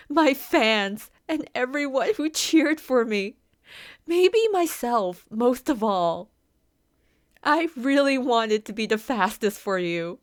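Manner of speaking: high, loud, cracking with tears; tearful breakdown. Acted. Injured racer sobbing apologies to her trainer, near tears. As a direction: apologies spilling out as she breaks down crying.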